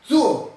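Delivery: suo sounded out carefully, accent on U; suo, weight on the U; stress on the U. Shown as a demonstration. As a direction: demonstrate the tricky word suo, stressing U. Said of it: "un poco difícil de pronunciarla".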